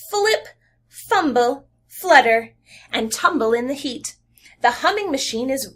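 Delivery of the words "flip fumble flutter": Flip, fumble, flutter each set apart; each F word separated for children; stressed F words. Shown as a demonstration. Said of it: "Listen to those three F words".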